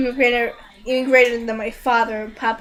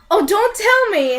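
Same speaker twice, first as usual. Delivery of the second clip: high, louder dramatic protest; mock dismay; 8 semitones higher, 5 dB louder than usual. Reviewer retelling the fight's twist, mock dismay. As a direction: exclaim it as if dreading the answer. Acts out dawning realization.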